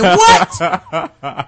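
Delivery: laughing, stunned what; laughing shocked what. Retold or imitated. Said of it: "I said".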